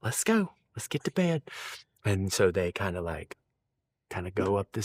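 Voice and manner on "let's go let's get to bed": a hint of a contented wife; happy old mama. Old wife leading husband to bed, higher. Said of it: "She said".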